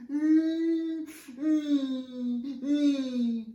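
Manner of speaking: high, loud whiny moaning; drawn-out whinging noises, pitched high; whinging sound. Shown as a demonstration. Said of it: "the whinging sound was a bit like this".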